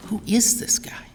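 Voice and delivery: breathy whisper, no voice; whispered aside. Retold or imitated. Brother whispering to brother at the door. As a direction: hush it so dad can't hear.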